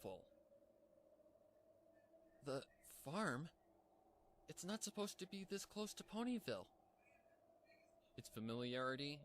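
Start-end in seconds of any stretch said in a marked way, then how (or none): 2.4-6.7 high, startled disbelief; shocked pony disbelief, much higher; surprised. Acted. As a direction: blurt it out in shock. Pinky's shocked thought.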